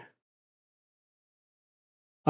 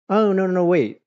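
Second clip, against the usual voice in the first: alarmed; 9 semitones higher, 6 dB louder than usual. Real, not acted. Realizes it's the wrong band.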